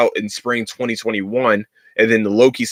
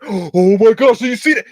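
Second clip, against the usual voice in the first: high, louder mock gasp of excitement; mocking excited moviegoer; 9 semitones higher, 5 dB louder than usual. Moviegoer gushing at the screen in a loud, high mock voice. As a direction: ham up the stranger who won't stop talking. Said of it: "you start, start getting".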